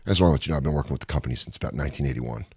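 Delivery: low murmured praise from a veteran crew guy; gruff old cameraman impression, hushed; older camera guy. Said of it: "He goes".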